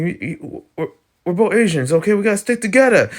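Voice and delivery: stammering start, then high and insistent; exaggerated pleading, pitched well up; mock solidarity plea. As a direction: play a hammed-up, high-pitched appeal for unity.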